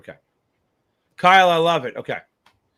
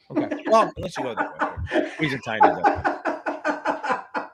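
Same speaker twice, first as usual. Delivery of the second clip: laughing; 7 semitones higher, slower than usual. Real, not acted. Laughing at a shirtless guy.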